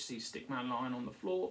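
usual speaking voice

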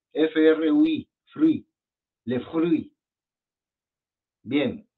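ordinary delivery

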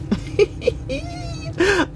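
high squealing laugh of joy; squeal of delight. Real, not acted. Delighted at her little angel in the candy dish, squealing laugh. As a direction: let out a happy, high squeal.

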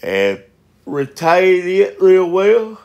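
slow words stretched by a yawn. Real, not acted. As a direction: talk right through a real yawn. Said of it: "guys, I'm yawning".

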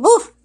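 high, loud playful woof; loud puppy bark. Pretend. Masked vlogger barking for the camera, loud high woof.

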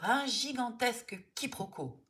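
woman shouting un gigantesque quiproquo; shouted. A narrator's reading. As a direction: yell un gigantesque quiproquo in protest. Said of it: "s'époumonne la femme".

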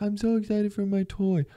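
put-on high kid voice, gushing; excited little kid. Imagined little kid about his toy, high and gushing. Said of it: "being like".

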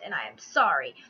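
real voice catching, dropping low on the apology; a genuinely teary apology, close to crying; choked-up sorry; near tears. Real, not acted. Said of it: "sorry if I seem kind of emotional right now".

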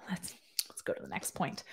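whispered aside. Real, not acted. Vlogger whispering an aside to viewers. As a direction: breathe it out as an aside. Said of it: "whispered aside".

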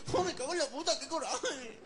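high, whiny mock outrage; exasperated child. Boy dressed like his brother, comically exasperated. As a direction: curse in a high, frustrated kid's voice. Child furious at matching clothes.

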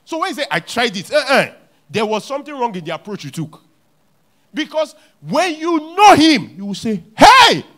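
loud, high preaching; full-force sermon shout. Acted. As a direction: proclaim it to the crowd.

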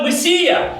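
high, drawn-out plea; crying out. Acted. Blind Bartimaeus crying out to the Messiah, pleading. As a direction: call out for mercy, pitched well up. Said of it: "Вартимей кричав".

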